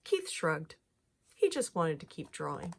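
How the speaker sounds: usual speaking voice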